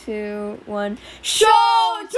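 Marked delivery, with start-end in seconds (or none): 1.2-2.2 much higher, very loud shout; Endeavor yelling. Shown as a demonstration. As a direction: yell the name like an angry father.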